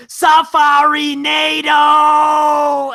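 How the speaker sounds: high, very slow screeching shout; hammed-up comedian squawk; Gilbert Gottfried yell. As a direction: stretch and screech the word. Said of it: "he gets real riled up".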